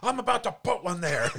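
high, loud cranky attendant voice; cranky attendant defending himself, high and loud; mock attendant. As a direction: snap it high like a petty worker. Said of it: "he's like".

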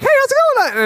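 very high, bubbly hey; eager greeting. Retold or imitated. Kids welcoming a first-time guest, high and bubbly. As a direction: greet like an overeager kid.

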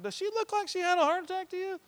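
very high, squeaky aside; mock incredulous. Grandson remarking on his lively sick grandma, incredulous. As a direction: pitch the question way up in disbelief.

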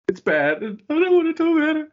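high, loud mock wail; mock upset. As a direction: shoot up high in playful distress. Mock upset over bad wrestling news.

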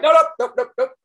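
high, loud string of nopes; emphatic refusal. Acted. Actor rejecting a rude mispronounced surname, emphatic high refusal.

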